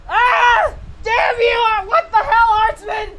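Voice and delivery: high, loud crying-out yell of disbelief; outraged shout. Real, not acted. Streamer yelling at a viewer's prank donation, loud and high.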